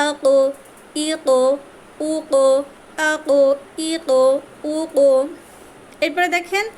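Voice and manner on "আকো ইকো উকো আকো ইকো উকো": আকো with the ক bounced off sharply; qalqalah bounce. Shown as a demonstration. Tajweed teacher drilling students, ক bounced. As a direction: echo the ক in আকো, ইকো, উকো with a pushed release. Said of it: "তার মাখরাজ থেকে কিতাকে পৃথক করবেন".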